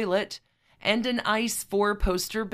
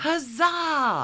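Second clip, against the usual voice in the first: mock cheer; 8 semitones higher than usual. Cheer in retelling.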